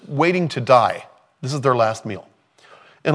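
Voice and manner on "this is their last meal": ordinary talk, nothing marked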